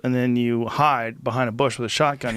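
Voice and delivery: ordinary talk, nothing marked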